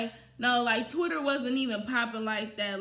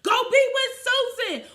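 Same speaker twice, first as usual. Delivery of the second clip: much higher and louder brush-off; shouted send-off to Susan; mock sassy shout; 11 semitones higher, 7 dB louder than usual. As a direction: crank the sass up on the repeat. Retold dismissing him, repeated.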